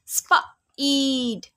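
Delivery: स्प, ईड stretched very slowly, sound by sound; sounding out speed. Shown as a demonstration. Teacher sounds out स्प ईड slowly for children. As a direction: drag out स्प, then ईड, slowly. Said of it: "वावेल का name ही सुनाई दे रहा है".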